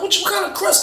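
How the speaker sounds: very high, soft astonished question; amazed roommate. Retold or imitated. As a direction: gasp it out in amazement.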